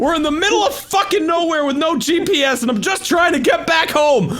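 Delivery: high, loud mock despair; exasperated shout. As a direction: rant like you're lost and fed up. Mock lost-and-exasperated rant at Elliot.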